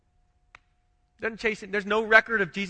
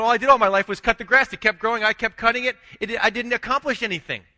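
louder, fast, higher exasperated complaint; mock lament; 5 semitones higher, 8 dB louder than usual. Preacher imitates a man at life's end lamenting grass-cutting, loud and fast. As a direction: rattle it off in fed-up despair. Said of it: "at the end of your life going".

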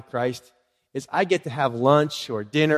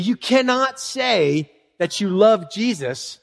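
ordinary talk

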